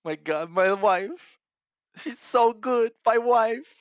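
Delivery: high, weepy imitation of a tearful husband; mock crying. Commentator mimicking streamer praising his wife, mock crying. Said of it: "why is he crying".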